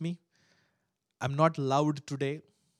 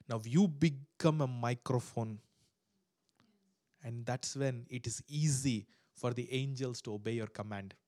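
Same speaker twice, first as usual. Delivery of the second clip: ordinary delivery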